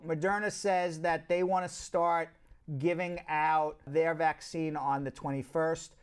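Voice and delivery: ordinary talk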